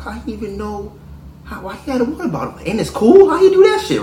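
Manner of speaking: high-pitched, incredulous gushing; amazed girlfriend. Acted. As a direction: play a girlfriend marvelling at her man. Acting out the impressed girlfriend.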